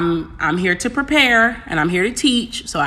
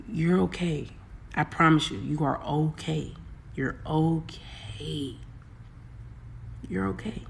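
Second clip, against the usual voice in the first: soft, slow, quiet comfort; calm them down gently; quiet, tender reassurance; hushed reassurance; 3 semitones lower, 13 dB quieter than usual. Real, not acted. Reassuring viewers.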